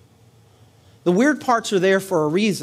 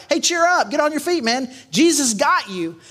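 cheery encouragement; 7 semitones higher than usual. Retold or imitated. Crowd voiced encouraging blind man.

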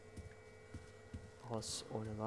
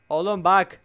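louder, higher urgent call; startled call; 4 semitones higher, 8 dB louder than usual. Real, not acted. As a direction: call out in alarm. Startled player repeats louder. (ürkmüş, yüksek sesle)

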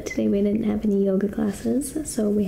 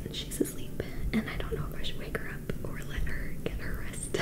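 soft breathy whisper; 10 dB quieter than usual. Real, not acted. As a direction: hush so she stays asleep. Roommate asleep nearby.

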